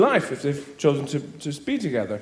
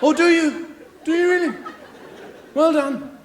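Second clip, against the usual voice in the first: sarcastic retort; 8 semitones higher than usual. Real, not acted. Mocking reply.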